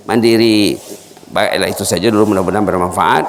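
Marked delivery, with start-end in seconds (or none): none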